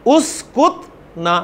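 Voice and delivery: उसकुत stretched slowly, each sound clear; slow demo. Shown as a demonstration. Teacher modelling the command उसकुत for boys, slow. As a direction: spell out उसकुत slowly for the class. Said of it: "मुजक्कर से कहा जाएगा उसकुत".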